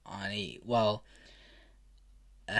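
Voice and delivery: plain reading voice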